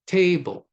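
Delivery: "table" with the first syllable "ta" stressed hard; trochaic stress. Shown as a demonstration. Lecturer shows students a trochaic word, stress on the first syllable of "table". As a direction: hit the start of "table", let the end fall light. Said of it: "Trochaic is stressed and unstressed".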